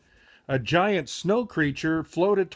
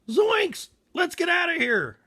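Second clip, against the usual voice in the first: very high panicked yell; cartoon Shaggy panic, much higher; terrified cry; 13 semitones higher than usual. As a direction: shriek it and bolt. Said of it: "Shaggy cried".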